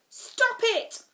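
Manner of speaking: high, loud shout. A narrator's reading. Max shouting at the two quarrelling girls. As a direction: scream at them to stop. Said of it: "shouted Max".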